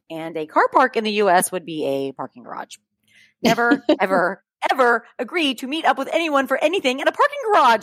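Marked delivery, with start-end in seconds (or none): 3.4-7.8 NEVER and ever leaned on hard, pitched higher as a warning; strong emphasis. Real, not acted. Host warning listeners about parking garages, emphatic. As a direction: hammer the warning home to the listener. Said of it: "I cannot stress this enough".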